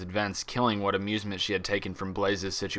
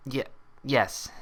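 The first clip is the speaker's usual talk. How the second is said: slight stammer; 4 semitones higher than usual. A narrator's reading. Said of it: "stammering slightly".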